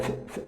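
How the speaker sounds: ف as a bare voiceless hiss, no vowel; unvoiced ف for the class; whispered f sound. Shown as a demonstration. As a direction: breathe out ف with no voice at all. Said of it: "حرف الإف صوته ف".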